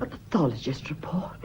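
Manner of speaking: a hushed, shocked echo; aghast whisper at the word report; horrified whisper. Acted. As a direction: breathe it out, appalled. Woman aghast at post-mortem.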